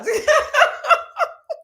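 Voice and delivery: shrieking laugh, head thrown back; loud high cackle. Real, not acted. Cackling loud at a viewer's comment.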